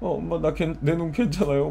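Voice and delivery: whiny retold self. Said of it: "막 이러고 있으니까".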